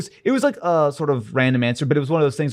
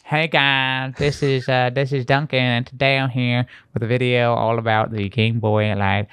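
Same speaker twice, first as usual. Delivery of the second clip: put-on old Missouri accent. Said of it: "What happened to the accent?".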